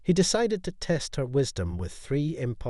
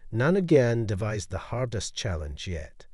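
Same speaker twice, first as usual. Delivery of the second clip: usual speaking voice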